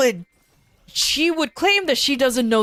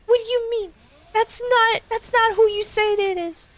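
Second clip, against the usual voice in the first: mock girlfriend voice; 13 semitones higher than usual.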